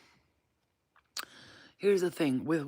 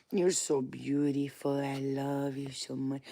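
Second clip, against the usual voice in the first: slow, soft endearment; tender cooing; 3 semitones lower, 3 dB quieter, slower than usual. Real, not acted. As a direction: murmur slow, loving words to a pet. Tender words to a pet.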